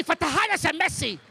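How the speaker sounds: rising hyped-up commentator chant; mock Arabic commentary.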